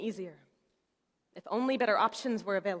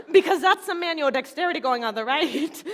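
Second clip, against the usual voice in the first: loud, higher, amused excitement; really excited; 5 semitones higher, 7 dB louder than usual. Retold or imitated. Mother proud of son's manual dexterity, really excited. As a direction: crow about it with a laugh. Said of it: "I got really excited".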